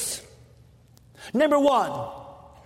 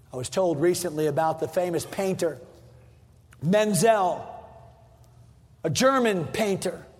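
ordinary talk, nothing marked

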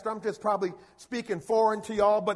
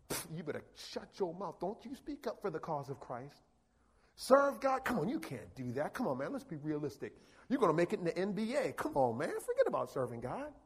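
devil's voice; 6 semitones lower, 8 dB quieter than usual. Retold or imitated. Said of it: "the devil that he wanna whisper".

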